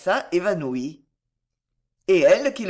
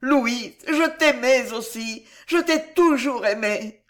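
high, impassioned confession; Juana's fervent declaration; 6 semitones higher, 4 dB louder, slower than usual. A narrator's reading. Juana confesses lasting love to Chico, high and fervent. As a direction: declare it ardently, voice raised high. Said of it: "clama la petite Juana".

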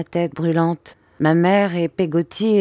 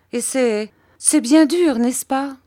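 pouting wife; 8 semitones higher, 4 dB louder, slower than usual. Acted. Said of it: "en faisant une petite moue".

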